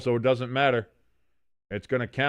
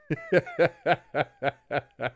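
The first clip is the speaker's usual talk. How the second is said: amused chuckle; 4 semitones higher than usual. Real, not acted. Laughs at own bit.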